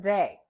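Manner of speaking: they with a loud voiced th; exaggerated th practice. Shown as a demonstration. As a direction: buzz the th in they. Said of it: "Listen again as I say".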